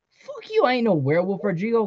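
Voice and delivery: high-pitched defensive comeback; acted squabbling, pitched high; angry retort. A narrator's reading. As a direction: throw it back at the accuser, voice up high.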